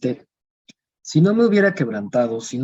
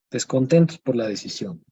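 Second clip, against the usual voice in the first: ordinary delivery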